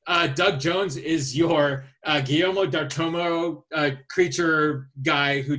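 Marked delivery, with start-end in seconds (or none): none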